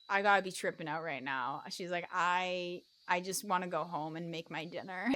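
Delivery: low, hushed, withdrawn voice; subdued unease. Retold or imitated. Woman unnerved by chanting kids, subdued self-talk. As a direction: drop pitch and volume, as if talking to yourself.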